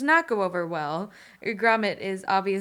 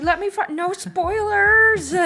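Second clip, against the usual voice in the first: mock protest; 11 semitones higher, 9 dB louder than usual. Acting out her own protest.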